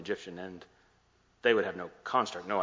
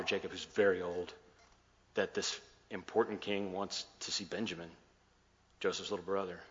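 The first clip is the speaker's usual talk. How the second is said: ordinary delivery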